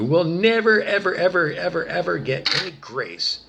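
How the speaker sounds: loud, high, insistent anger; vehement denial. Real, not acted. Telling evil people they get no grace, vehement. Angry vow of no forgiveness.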